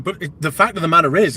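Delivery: ordinary delivery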